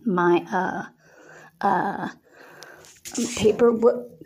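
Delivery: halting, stumbling words; stammering. A narrator's reading. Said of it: "Cody stammered".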